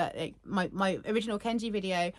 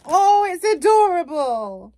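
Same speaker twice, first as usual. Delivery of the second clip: louder, slow gush of delight; 6 semitones higher, 11 dB louder, slower than usual. Real, not acted. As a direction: swoon over something cute. Opening the plush, delighted.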